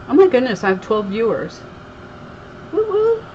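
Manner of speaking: ordinary delivery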